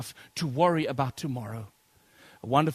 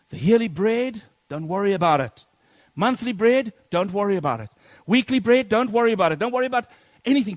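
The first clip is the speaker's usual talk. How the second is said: louder and higher with each line; preacher driving home not to worry; building preaching; 5 semitones higher, 8 dB louder than usual. Acted. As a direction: build the list to a crescendo.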